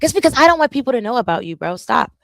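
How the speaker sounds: teasing her friend on TikTok; playful protest. Real, not acted. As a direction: rush it out to a friend.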